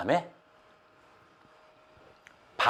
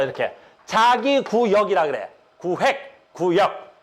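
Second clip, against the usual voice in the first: usual speaking voice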